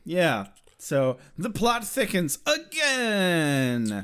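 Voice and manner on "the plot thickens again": drawn-out, theatrical announcement; mock-dramatic. Co-host announcing the plot twist, mock-dramatic. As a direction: stretch the final word like a melodrama narrator.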